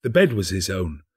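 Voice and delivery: plain reading voice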